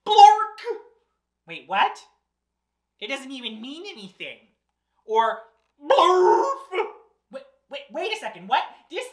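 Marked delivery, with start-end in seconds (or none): 0.1-0.6 high, explosive nonsense word; loud nonsense blurt. Acted. Reader blurting the book's silly word to children, loud blurt. 5.8-6.5 high, booming nonsense noise; loud nonsense blurt. Acted. Reader forced to say silly words, loud silly blurt. Nonsense word the book makes her say.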